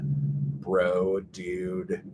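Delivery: slow California surfer-dude accent; California bro drawl. Shown as a demonstration. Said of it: "I think I talk very slow".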